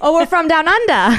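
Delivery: playful put-on Australian, "under" drawled as "undah"; mock Australian accent. Co-host joining the joke in a hammed-up Australian accent. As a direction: join in with a cheesy Australian voice. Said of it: "We're, we're Australian today".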